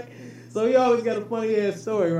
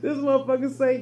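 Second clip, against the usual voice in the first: ordinary talk, nothing marked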